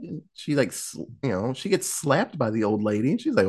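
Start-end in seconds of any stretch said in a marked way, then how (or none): none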